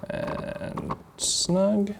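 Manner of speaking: "snug" lifted high with soft emphasis; high, gentle lean on "snug"; stress on snug. Shown as a demonstration. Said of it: "I wanna use the emphasis on the word snug".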